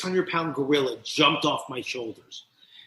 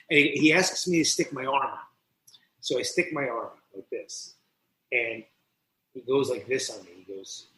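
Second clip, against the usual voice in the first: ordinary talk, nothing marked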